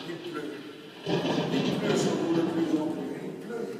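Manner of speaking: slow, soothing hypnotist voice; gentle relaxation patter; calm hypnotic suggestion. Acted. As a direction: lull them back to calm. Hypnotist calms the subjects.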